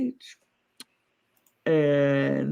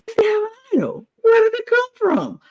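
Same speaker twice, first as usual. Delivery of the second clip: much higher, fast panicked voice; panicked Christopher; faster than usual. Retold or imitated. Imitating Christopher learning of the idol, high panicked squeak. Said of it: "Christopher's like".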